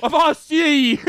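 high mock old British cry; silly British accent. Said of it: "such a silly accent".

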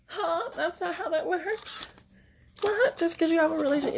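high-pitched, baffled protest; high mock outrage at the friend's words, fast at the end; incredulous disbelief. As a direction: squeak it out in total confusion. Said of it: "that's what I was thinking".

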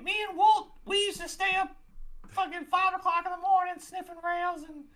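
very high cartoon mouse voice; Mickey Mouse. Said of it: "Mickey, how you doing?".

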